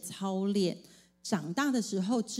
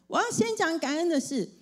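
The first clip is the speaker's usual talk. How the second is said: put-on higher, eager little girl raising her hand; eager child; 9 semitones higher than usual. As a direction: announce it like a keen child. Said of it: "她就举手". (女儿举手抢着说，声音偏高)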